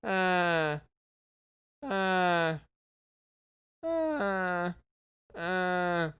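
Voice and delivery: long droning mummy "uh"; mummy groan imitation. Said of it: "what that dude sounded like".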